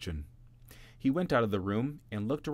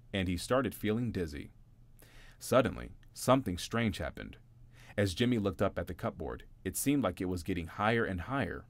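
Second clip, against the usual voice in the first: ordinary delivery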